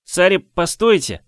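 high, slow call after her. Acted. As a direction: call her back before she leaves. Boy calls after departing rider.

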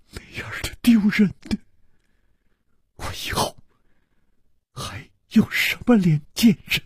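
tearful, broken whispers and high cries; sobbing. A narrator's reading. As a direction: cry it out between sobs. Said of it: "石秀云忽然放声大哭".

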